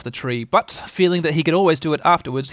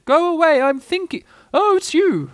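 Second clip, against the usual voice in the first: very high, loud hooting owl voice; irritable Owl; 7 dB louder than usual. Acted. Owl answers the door to Rabbit, high and irritable. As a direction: shoo the caller away in a high owl voice. Owl's voice in the story.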